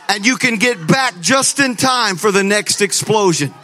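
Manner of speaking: loud, high-pitched rallying pitch; excited, building to a loud command; hyped-up urgency. Acted. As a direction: sell the app's instructions like an emergency. Hyped acting-out of app's instructions.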